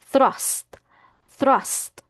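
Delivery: "thrust" starting with a clear TH; "thrust" as th-rust, carefully separated; TH onset. Shown as a demonstration. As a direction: say "thrust" with tongue-between-teeth TH before the R. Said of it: "Next, th, th".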